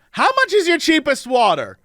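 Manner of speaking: high-pitched mock tipsy woman; drunk Vegas woman. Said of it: "one of the women turns to her and goes".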